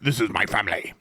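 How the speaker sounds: low, quiet Worf voice, solemn and proud. Retold or imitated. Quoting Worf's vow to his crew, low and solemn.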